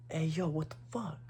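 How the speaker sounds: high, hushed mock yell; voice from behind. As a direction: imitate a man calling out, kept soft. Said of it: "behind me I hear".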